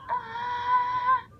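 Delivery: short high cartoon yelp; mock scream. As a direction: yelp like the burning character. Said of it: "And he's like".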